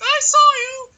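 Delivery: mock shrill teacher. Teacher insisting she saw him, shrill mock accusation. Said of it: "She's like".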